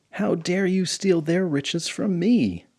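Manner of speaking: high, slow outraged demand; indignant queen. Acted. Queen scolding the bandit chief, high slow outrage. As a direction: scold the thief like royalty.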